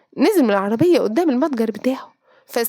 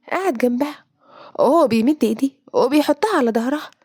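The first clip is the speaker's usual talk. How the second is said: ordinary delivery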